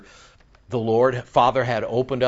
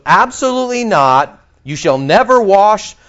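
emphatic refusal; 7 semitones higher, 8 dB louder than usual. Retold or imitated. Peter's emphatic double negative.

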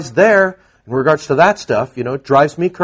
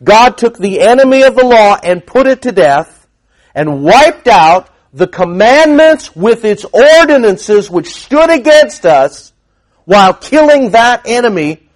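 preaching; 6 semitones higher, 5 dB louder than usual. Acted. Preaching the passage aloud.

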